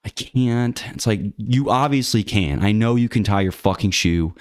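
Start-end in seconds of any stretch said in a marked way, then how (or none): 0.1-0.8 whiny helpless person. Retold or imitated. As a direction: imitate someone giving up before trying. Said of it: "You're like".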